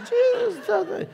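very high, comic wail; hammed-up plea with a laugh in it; crying out. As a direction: cry out in a silly high voice. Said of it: "demonstrate what that sounds like".

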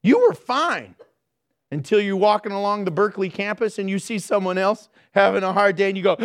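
ordinary delivery

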